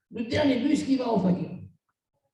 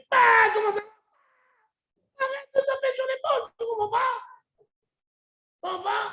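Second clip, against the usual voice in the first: hammed-up back-slapper; 12 semitones higher than usual. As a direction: play the guy who slaps shoulders too hard. Mimics loud back-slapping greeter. (voix aiguë et tonitruante d'un gros tapeur d'épaule)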